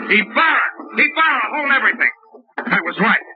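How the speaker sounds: high, urgent call. Acted. Detective calling out to the lieutenant to halt everything. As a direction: shout to stop him in his tracks.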